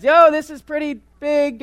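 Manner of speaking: high, loud call across the gym; yelling for help. Retold or imitated. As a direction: shout out to the other dads in alarm. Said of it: "I'm yelling for the other dads".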